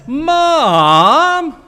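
"Mom" stretched high and loud, its vowel bending; whiny kid's Mom. Retold or imitated. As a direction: groan "Mom" at an impossible request. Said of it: "changing vowel in there".

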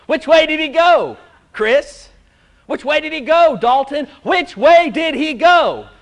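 preacher calls on members one by one, loud and high; calling out to congregation. Real, not acted. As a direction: challenge the room with the question, loud and high. Preacher quizzes congregation by name.

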